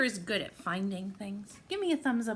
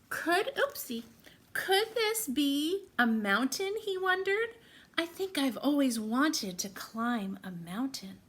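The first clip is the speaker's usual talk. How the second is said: louder, suspenseful read-aloud; excited storytime narration; 4 semitones higher, 4 dB louder than usual. Acted. Teacher reading the escalator surprise to the class, excited. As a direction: build the surprise for the kids. Reads picture book to children.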